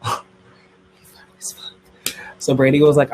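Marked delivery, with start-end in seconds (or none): 0.0-1.7 slow breathless whisper after a gasp; dramatic whisper. A narrator's reading. Telling viewers Brady finally spoke, slow dramatic whisper. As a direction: hush it like a juicy secret.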